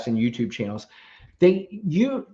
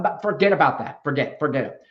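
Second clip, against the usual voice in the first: ordinary talk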